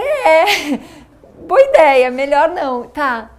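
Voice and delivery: bursting out laughing, high and loud. Real, not acted. Mother laughing as she answers her angry daughter. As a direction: laugh through the words.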